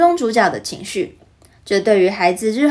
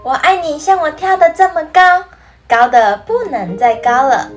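excited; 6 semitones higher, 7 dB louder than usual. Acted. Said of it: "小兔子笑了起来".

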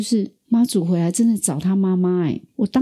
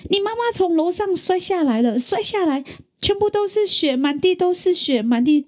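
imitating a frantic dad in tears, much higher; panicked crying father; 12 semitones higher than usual. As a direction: pitch jumps high, mock-panicked and tearful. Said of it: "一直哭，一直哭". (模仿父亲哭着惊慌地说，声音高很多)

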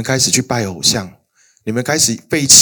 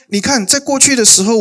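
much higher, raised voice of God reasoning; God pleading; 11 semitones higher than usual. Acted. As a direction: plead as God reminding his people. Preacher voices God arguing. (高声扮演上帝讲理)

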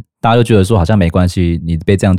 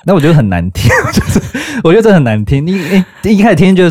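laughing; 11 semitones higher, 3 dB louder, faster than usual. Real, not acted. Laughing while telling the story.

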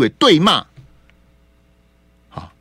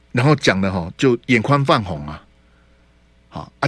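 usual speaking voice

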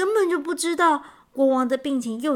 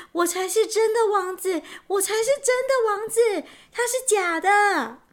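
very high, insistent cry; desperate protest; 10 semitones higher, 4 dB louder than usual. A narrator's reading. Prince pushes forward protesting to crowd. (急切地高声喊冤)

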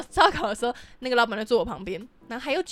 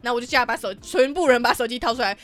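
ordinary delivery

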